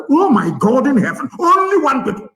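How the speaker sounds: high-pitched, appalled exclamation; indignant disbelief, pitch leaping up; exasperated outcry. Real, not acted. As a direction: cry out to heaven in exasperation. Exasperated at one people's infighting.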